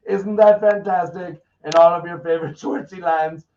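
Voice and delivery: mock enthusiasm. Real, not acted. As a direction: sell it like a game show host. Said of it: "Isn't that nice?".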